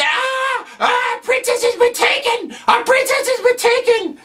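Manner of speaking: put-on very high, shouting little kid; panicked kid voice. Mushroom kid cries to Mario, high panicked squeal. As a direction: squeal it like a frantic tiny child.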